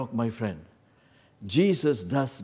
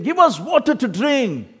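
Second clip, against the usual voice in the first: higher, louder angry demand; demanding shout; 9 dB louder than usual. Retold or imitated. Israelites demanding water from Moses, angrily. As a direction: bark it at Moses like a thirsty mob. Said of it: "They were saying".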